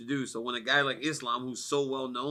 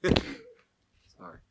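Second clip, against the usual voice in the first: laughing. Real, not acted. Laughing apology after rant.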